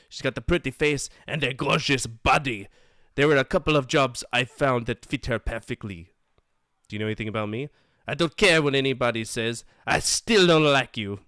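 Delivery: brash greasy photographer voice; sleazy photographer voice. Acted. Voices photographer reading text.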